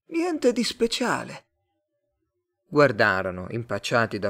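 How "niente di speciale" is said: high, slow alien voice; reply as a high-voiced alien; drawn-out, otherworldly Martian. A narrator's reading. Martian character replies.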